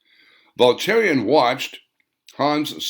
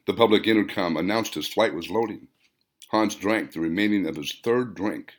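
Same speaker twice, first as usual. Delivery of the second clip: ordinary delivery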